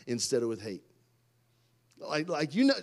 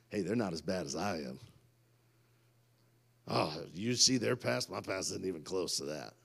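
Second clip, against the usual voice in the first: low, hushed mock-judging voice; smug condemning aside; 8 semitones lower, 8 dB quieter than usual. Preacher voicing Christians who compare pasts, smug and hushed. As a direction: drop it low, like quietly judging someone.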